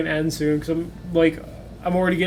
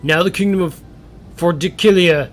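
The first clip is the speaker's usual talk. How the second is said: father character; 4 dB louder than usual. Acted.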